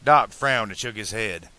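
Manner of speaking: ordinary delivery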